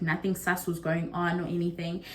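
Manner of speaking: ordinary talk, nothing marked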